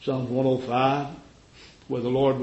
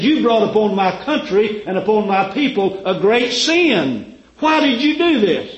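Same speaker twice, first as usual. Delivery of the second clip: high, loud scolding; rebuke; 8 semitones higher, 7 dB louder than usual. Acted. Abimelech rebuking Abraham.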